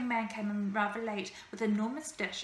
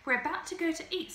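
bearded lady voice; 6 semitones higher than usual. A narrator's reading. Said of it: "said the bearded lady".

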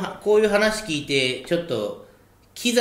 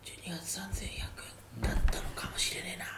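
retold sneaky whisper, much softer; 17 dB quieter, faster than usual. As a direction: whisper it close to his ear like a secret. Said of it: "金ちゃんがちっちゃい声で、ささやきおかみの距離で". (耳元でこっそりささやく声)

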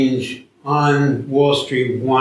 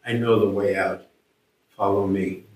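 calm reassurance; 4 semitones lower, 4 dB quieter than usual. Acted. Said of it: "He says".

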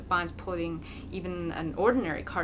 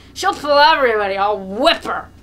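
put-on drunken yell; 3 semitones higher, 15 dB louder than usual. A narrator's reading. Mokolka keeps yelling.

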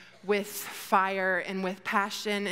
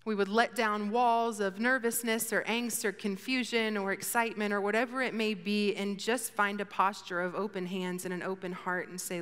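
ordinary delivery

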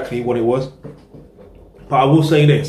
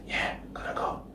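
whisper; 16 dB quieter than usual. Real, not acted. Aside while leaving, voice drops.

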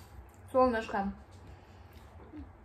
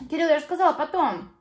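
stern calling out; 4 semitones higher, 9 dB louder than usual. Real, not acted. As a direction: tell him off loudly. Mother scolding son. (строго и громко)

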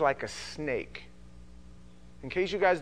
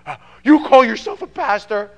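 indignant shout; 8 semitones higher, 15 dB louder than usual. Retold or imitated. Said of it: "And they go".

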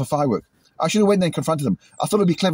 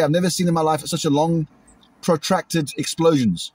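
ordinary talk

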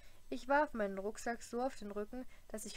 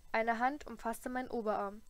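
usual speaking voice

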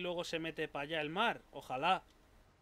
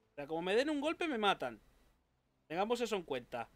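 usual speaking voice

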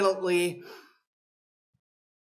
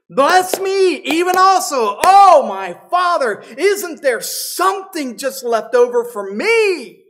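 loud, much higher begging cry; desperate plea; 6 semitones higher, 8 dB louder than usual. Acted. Esau begging his father for any blessing left. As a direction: plead with everything left.

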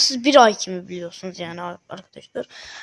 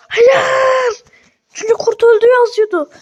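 much higher, loud wail of loss; anguished shout; 10 semitones higher, 7 dB louder than usual. Real, not acted. Owner wailing that his pet wolf died. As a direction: cry out in disbelief.

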